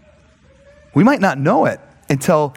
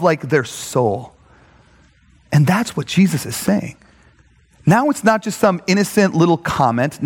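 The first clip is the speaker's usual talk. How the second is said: ordinary talk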